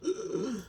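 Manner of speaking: a sharp, high, breathy gasp for air; imitated gasp. Darth Vader struggling to breathe, one high gasp. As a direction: gasp like a dying man's last breath. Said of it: "at the end of episode six when he's like".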